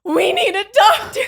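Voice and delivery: high, loud, half-laughing call for help; urgent call for a doctor. Retold or imitated. She shouts to the cabin for a doctor, urgent and loud. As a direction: cry out that you need a doctor.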